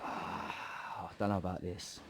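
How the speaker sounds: whispered doubt before the first round; nervous whisper. Retold or imitated. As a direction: hang back, scared to spar.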